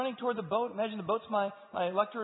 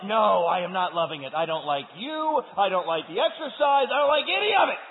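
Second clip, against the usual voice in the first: loud protest; 5 semitones higher, 11 dB louder than usual. Acted. Answering the workout trainer.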